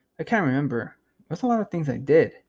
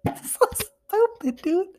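very high, squeaky words through laughter; helpless laughter. Real, not acted. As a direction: squeeze the words out while cracking up. Helpless laughter at own bit.

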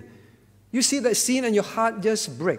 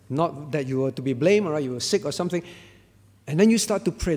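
usual speaking voice

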